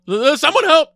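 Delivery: mock panic. Frantic call at empty gate.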